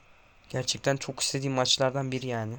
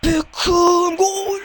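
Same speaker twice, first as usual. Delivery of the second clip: long drawn goal roar, much higher and louder; slow, soaring goal yell; goal scream; 13 semitones higher, 11 dB louder, much slower than usual. Real, not acted. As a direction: scream the goal like a stadium explodes. Scores equaliser in game.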